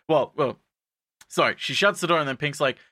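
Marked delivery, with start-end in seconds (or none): none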